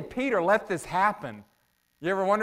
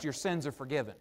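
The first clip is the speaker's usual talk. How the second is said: quiet aside; 4 semitones lower, 9 dB quieter, faster than usual. Shown as a demonstration. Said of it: "kinda hard to put to the test".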